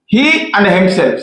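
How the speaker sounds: ordinary delivery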